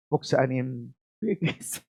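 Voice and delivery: ordinary delivery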